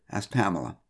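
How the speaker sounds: ordinary delivery, nothing marked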